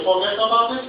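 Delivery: unsure Indian-accented attempt at New York slang. Clerk practises the phrase on customer, hesitant Indian accent. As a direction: say it as a question, with a put-on Indian accent. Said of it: "Don't say it like that".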